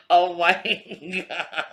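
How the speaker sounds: slow words through real laughter; laughing. Real, not acted. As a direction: gasp then laugh it out. Laughing at kid's remark.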